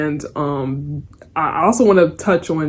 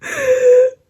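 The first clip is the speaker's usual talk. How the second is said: high, crying-with-laughter burst; real helpless laugh; 4 dB louder than usual. Real, not acted. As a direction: laugh until it almost turns to tears. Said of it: "I've never laughed so loudly and hard".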